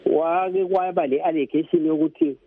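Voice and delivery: ordinary talk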